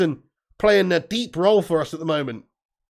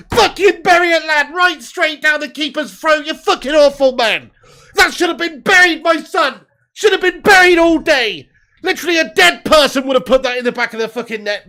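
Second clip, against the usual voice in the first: loud, higher, exasperated rant at a miss; frustrated yelling; 5 semitones higher, 4 dB louder than usual. Real, not acted. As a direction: berate the striker who fluffed it. Fan furious at missed chance.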